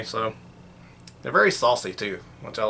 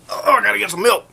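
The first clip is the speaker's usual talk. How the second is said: normal talking voice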